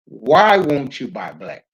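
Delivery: ordinary talk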